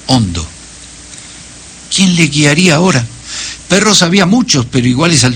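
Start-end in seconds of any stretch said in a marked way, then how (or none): none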